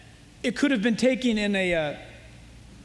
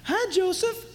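flirty greeting; 8 semitones higher than usual. Retold or imitated. Potiphar's wife calling to Joseph, high and flirty. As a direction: coo it like a temptress.

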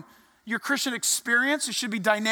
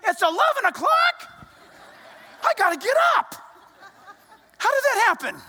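high, loud startled cry; loud alarmed exclamation =105; surprised; 10 semitones higher, 3 dB louder than usual. Retold or imitated. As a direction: panic at the late hour. Surprised at the late hour.

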